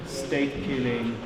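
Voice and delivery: ordinary delivery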